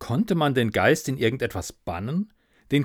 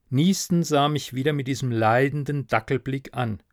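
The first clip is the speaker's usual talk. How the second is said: ordinary delivery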